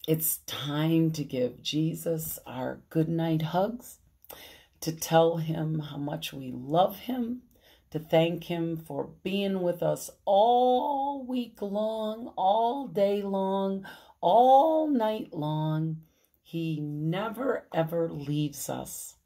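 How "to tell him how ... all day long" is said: plain reading voice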